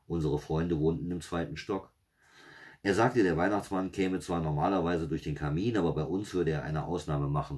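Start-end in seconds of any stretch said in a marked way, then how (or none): none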